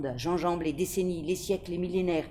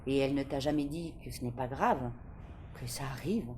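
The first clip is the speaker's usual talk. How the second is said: heavy, sad; 4 semitones lower, 5 dB quieter than usual. A narrator's reading. Librarian asks about the mother, heavy and sad. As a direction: lower and softer, weighed down. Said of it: "sa voix est soudain lourde".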